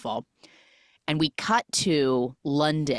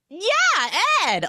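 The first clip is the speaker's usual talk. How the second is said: high, emphatic mock confirmation; bluffing agreement; 4 dB louder than usual. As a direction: seize on the name as if you knew it. Acting caught woman bluffing the name.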